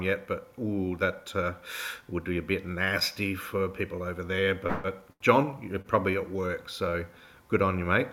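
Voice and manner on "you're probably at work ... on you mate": ordinary talk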